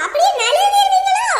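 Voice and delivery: loud, high sneering jab; mocking taunt. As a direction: taunt him for slipping away. (கிண்டலான சத்தமான கேலி)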